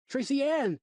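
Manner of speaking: high, loud frantic call; shouting for daughter. A narrator's reading. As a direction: call out across the park. Father calling lost daughter.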